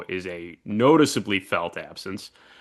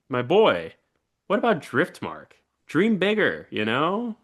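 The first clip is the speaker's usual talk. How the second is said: much higher, lilting persuasion; coaxing Ormond; 6 semitones higher than usual. Retold or imitated. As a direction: tempt him to dream bigger, lightly mocking. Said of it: "Ormond's like".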